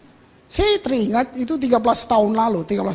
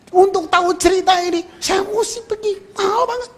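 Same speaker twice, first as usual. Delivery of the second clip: higher, emphatic; excited exclaiming; 9 semitones higher than usual. Real, not acted. Preacher marveling at the cost, higher and emphatic. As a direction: lift it up in amazement.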